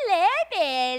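put-on choppy Yucatecan accent. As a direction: exaggerate the Yucatecan cadence. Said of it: "hablamos un poco aporreado".

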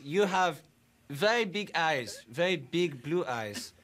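put-on broken English with a German accent. A German-accented interviewer greets his guest politely. Fake foreign interviewer throughout.

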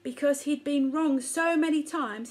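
usual speaking voice

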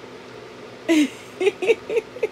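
airless high giggle; breathy giggle. Real, not acted. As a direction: giggle under your breath. Watching aunt react to present.

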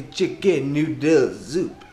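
hammed-up French accent; badly faked French accent. Vlogger announcing tonight's dinner, mock French accent. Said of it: "That's my French accent".

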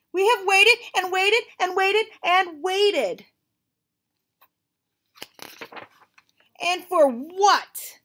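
high, loud, slow grumble; exasperated complaint. Acted. Gerald complains about all the waiting, high loud exasperation. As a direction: pile up the frustration.

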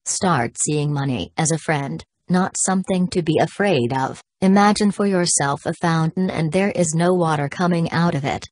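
normal talking voice

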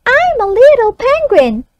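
put-on high child voice echoing back. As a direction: echo it like a young pupil. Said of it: "the child reads it back".

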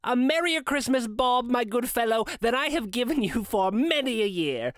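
high-pitched, joyful outpouring to Bob; hearty earnestness. A narrator's reading. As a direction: pour out goodwill with eager sincerity. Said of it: "with an earnestness that could not be mistaken".

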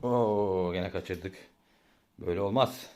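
ordinary talk

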